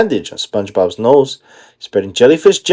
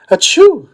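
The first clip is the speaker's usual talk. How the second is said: high loud sneeze; 13 semitones higher, 4 dB louder than usual. Acted. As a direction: sneeze cutting the line.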